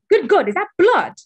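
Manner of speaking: high, loud horrified gasp; sudden horror; shocked. Real, not acted. Sees blood on window.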